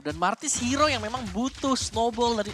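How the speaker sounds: fighting game announcer. Real, not acted. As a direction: keep the hype going. Co-caster hyping snowball. (komentator bersemangat)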